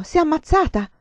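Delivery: high, loud anguished cry; shout. Acted. Girl screams her fear to the nun, high and loud. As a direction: cry it out in horror. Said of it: "E poi gridò".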